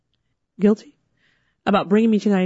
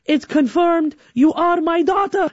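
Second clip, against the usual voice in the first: proud dad; 9 semitones higher than usual. Retold or imitated. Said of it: "He's like".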